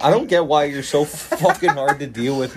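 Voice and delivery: loud, fed-up outburst; exasperated yelling. Real, not acted. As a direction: vent it, fully annoyed. Exasperated rant at friend.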